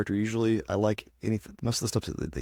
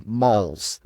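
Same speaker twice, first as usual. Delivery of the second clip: put-on Southern accent; 6 dB louder than usual. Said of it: "your cracker accent is turning that W into L's".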